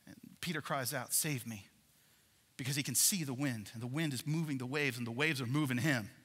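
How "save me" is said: hushed plea. Retold or imitated. Peter's cry retold softly.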